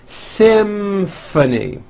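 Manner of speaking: SYMPHONY slow and high, M held into F. Shown as a demonstration. Teacher modelling a tricky word, slow M-to-F SYMPHONY. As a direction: draw out SYMPHONY so M and F both sound. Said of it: "M sound followed by an F sound".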